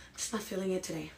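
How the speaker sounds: soft, quick, downbeat reply; out of the mood. Acted. Answering a compliment when not in the mood, downbeat. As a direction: brush off the compliment quietly. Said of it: "not in the right mood".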